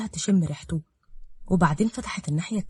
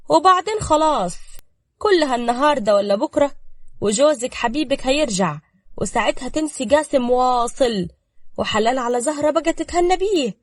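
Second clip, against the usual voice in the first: sly cunning; 6 semitones higher, 6 dB louder than usual. A narrator's reading. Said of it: "وصفاء كملت بمكر".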